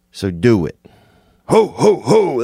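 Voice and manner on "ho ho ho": high-pitched jolly belly-laugh call; Santa Claus. Acted. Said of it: "That's Santa".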